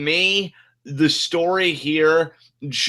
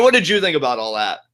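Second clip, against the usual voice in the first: ordinary talk, nothing marked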